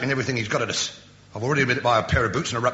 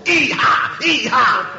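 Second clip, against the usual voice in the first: high loud yelp; bayonet war cry; 11 semitones higher, 6 dB louder, much slower than usual. Shown as a demonstration. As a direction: give a savage yell. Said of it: "like this".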